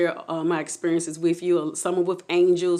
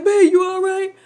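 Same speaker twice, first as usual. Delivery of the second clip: imitated worried husband; 11 semitones higher, 7 dB louder than usual. Voicing husband's worried question.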